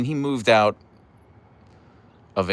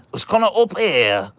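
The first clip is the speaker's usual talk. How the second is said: much higher, louder Northern English smoker voice; gravelly granddad; 10 semitones higher, 5 dB louder than usual. Retold or imitated. Grandchild exaggerating granddad's voice, high, loud gravelly Northern English. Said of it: "it was very gravelly. It was kinda up here".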